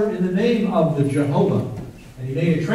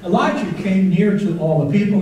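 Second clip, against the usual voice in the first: ordinary talk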